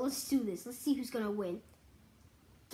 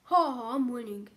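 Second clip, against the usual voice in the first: usual speaking voice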